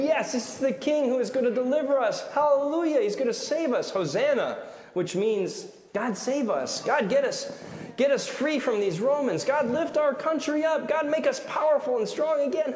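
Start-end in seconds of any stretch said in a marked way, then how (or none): 0.0-4.6 high, jubilant proclaiming; crowd cheering. Retold or imitated. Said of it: "It turned their cheers". 5.9-12.7 high, fervent pleading cheer; parading crowd. Retold or imitated. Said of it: "parading and saying".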